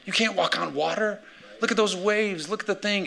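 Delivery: high, incredulous scoffing voice; mock the disciples calling from the boat; high disbelieving shout-free scoff; doubting disciples. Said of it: "I imagine the disciples are in the boat being like".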